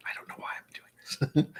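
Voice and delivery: hushed aside to herself; whispering. Real, not acted. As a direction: mutter it under your breath. Aside during tasting.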